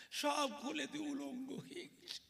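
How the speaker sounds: ordinary talk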